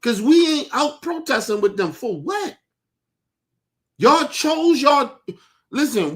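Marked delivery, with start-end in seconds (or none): 4.0-5.2 loud, slow accusation. Real, not acted. Heated rant about voting blame.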